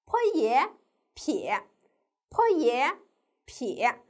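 坡耶 in two parts, then blended into 撇; syllable blending demo. Shown as a demonstration. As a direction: sound out 坡耶, pause, then 撇 as one syllable. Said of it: "我们可以怎么拼呢".

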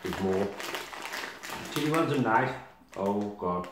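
chewing while talking; words muffled by snack; mouth half full. Real, not acted. Seen 1: Eats snack pieces from bag, hand to mouth, chewing between words.

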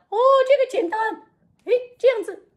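high puppet voice. Acted. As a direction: play a cheerful little puppet. Puppet Frankie kneels.

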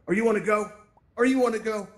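a retold half-serious threat; mock confrontation. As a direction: square up like someone picking a fight. Avatars approach, picking a fight.

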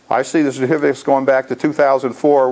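ordinary delivery

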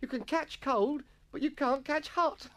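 high, slow schoolboy relishing a joke; feigned hilarity. Acted. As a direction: savour it in mock mirth.

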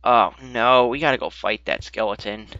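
ordinary talk

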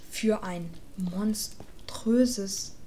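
slow, fading dramatic whisper; mock-epic whisper. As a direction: hush it like a trailer.

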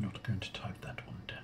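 unvoiced whispered aside; whispering. Real, not acted. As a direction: whisper it under your breath. Muttered aside while typing.

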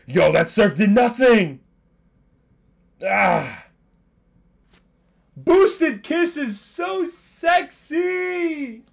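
high, loud, slow squeal of delight; excited shrieking. Real, not acted. Streamer thrilled by his Pokemon's attack, shrieking high and slow. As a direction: squeal the words out, drawn long. Reacting to battle move.

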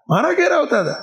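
high, incredulous echo question; acted surprise. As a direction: play the bandit lightly taken aback. Bandit taken aback by visitors.

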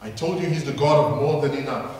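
ordinary delivery

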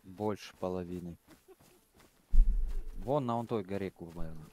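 ordinary delivery

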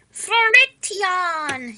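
slow, drawn-out Irish-style exclamation; leprechaun accent. Shown as a demonstration. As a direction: ham up a cheesy leprechaun lilt. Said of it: "that's more leprechaun".

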